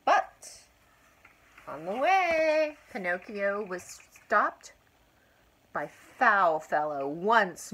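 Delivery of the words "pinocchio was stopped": ordinary delivery, nothing marked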